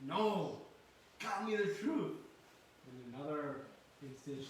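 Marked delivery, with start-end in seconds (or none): 0.0-2.2 insistent mother. Acted. Voicing mother insisting on truth.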